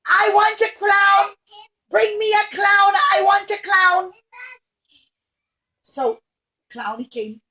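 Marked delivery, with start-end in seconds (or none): 0.1-4.1 higher, louder spoiled demands; bossy princess demand. Acted. Spoiled princess demands a clown, bossy and loud. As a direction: order it like a spoiled royal. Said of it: "One day she said".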